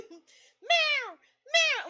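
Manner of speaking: high, loud imitated meow; frantic cat. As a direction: yowl like a cat throwing a mock fit. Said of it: "he's losing his shit".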